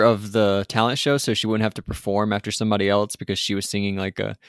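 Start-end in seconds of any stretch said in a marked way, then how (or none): none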